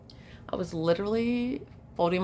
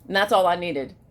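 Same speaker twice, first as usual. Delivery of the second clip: ordinary talk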